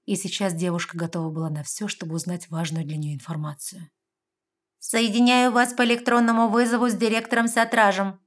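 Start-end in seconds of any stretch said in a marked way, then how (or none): 4.8-8.2 high, loud machine announcement; electronic voice. A narrator's reading. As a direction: announce like a computer. System connects the call.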